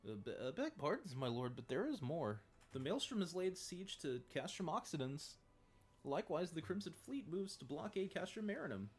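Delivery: high, loud, anxious soldier reporting; nervous underling voice. Acted. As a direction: cower as you report bad news. Underling reports more news.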